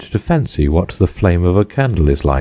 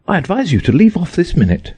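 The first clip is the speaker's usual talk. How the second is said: high, quick and sharp; sharp command; 11 semitones higher, faster than usual. A narrator's reading. Alice ordering herself to stop crying, fast and sharp. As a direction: snap at yourself to stop. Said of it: "rather sharply".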